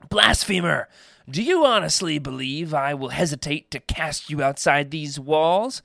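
loud, high outburst of a queen; acted royal fury; enraged. A narrator's reading. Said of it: "Her rage flared".

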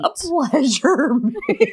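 slow, breaking into laughter; real laughing disbelief. Real, not acted. Co-host repeats the silly term to host, laughing. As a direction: crack up while repeating it, dragged out.

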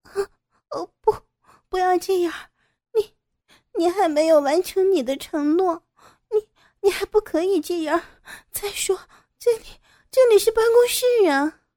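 startled woman pushing him away, high and frantic; frightened cry. Acted. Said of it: "傅菊英惊叫道".

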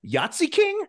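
high-pitched mock puzzled question; imitating puzzled wife. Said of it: "she goes".

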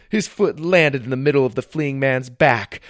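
plain reading voice

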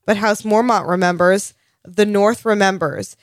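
ordinary talk

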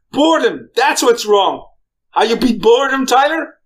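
impassioned retold speech, pitched high; dramatic movie pilot. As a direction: imitate the pilot proclaiming his big revelation.

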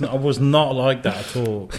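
ordinary talk

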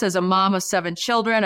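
ordinary talk

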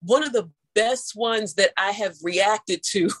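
ordinary delivery

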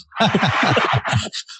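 high laughing burst; laughing hard. Real, not acted. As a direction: laugh it out after the song. Laughing after the song.

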